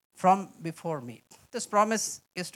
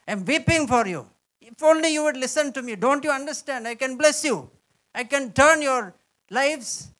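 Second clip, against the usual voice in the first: higher, louder, yearning plea; pleading heavenly father; 5 semitones higher, 5 dB louder than usual. Acted. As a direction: beg your children to listen. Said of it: "heavenly father reaching out his hand and saying".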